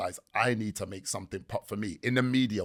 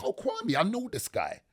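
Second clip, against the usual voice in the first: surprised recognition; 12 semitones higher, 4 dB quieter than usual. Retold or imitated. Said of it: "I was like".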